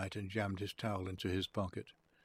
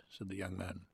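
usual speaking voice

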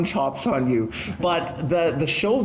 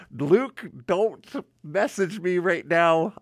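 ordinary talk, nothing marked

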